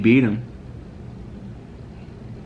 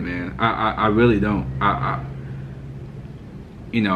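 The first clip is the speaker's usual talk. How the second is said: ordinary talk, nothing marked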